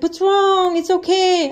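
high-pitched worried question; concerned husband. Retold or imitated. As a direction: imitate an alarmed, comforting husband. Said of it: "I started crying so bad. He's like".

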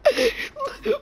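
squealing, tearful laugh; high helpless laugh. Real, not acted. Cracking up at the update, high helpless laugh. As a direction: laugh high until near crying.

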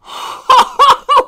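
real high, loud laughter breaking into a sob; crying laugh. Real, not acted. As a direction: laugh until it cracks into tears. Overwhelmed by card pull.

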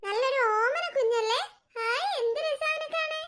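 high, drawn-out cooing; adoring coo. Acted. Aunt coos over the child. (കൊഞ്ചലോടെ നീട്ടി, ഉയർന്ന ശബ്ദത്തിൽ)

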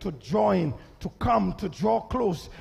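ordinary delivery

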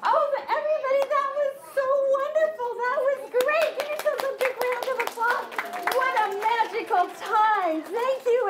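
high, bright gushing with a laugh; gushing praise. Acted. Host cheering the audience, high excited praise. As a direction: overdo the delight.

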